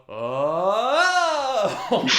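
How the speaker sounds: a big, high, loud cheering "Oh!"; group cheer. Acted. Table crowd cheering at the handshake, high and loud. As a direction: cheer like a rowdy gambling table.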